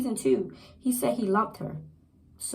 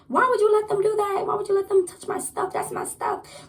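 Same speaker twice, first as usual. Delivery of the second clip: high-pitched mock outraged rant; acted-out frantic complaint; going off; 12 semitones higher than usual. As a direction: pitch it way up and keep protesting. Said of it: "she was just going off".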